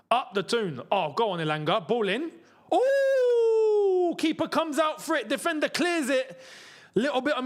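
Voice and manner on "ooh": a sudden high, loud ooh at the chance; excited ooh. Real, not acted. Fan watching a corner fly in, excited ooh. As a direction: gasp it out as the ball comes in.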